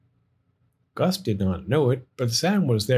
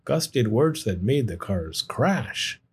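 ordinary delivery, nothing marked

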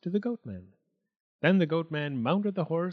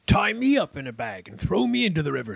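higher, louder, greedy demand; eager goatman; 4 semitones higher, 6 dB louder than usual. A narrator's reading.

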